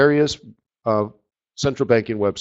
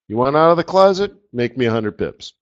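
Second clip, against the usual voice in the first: ordinary talk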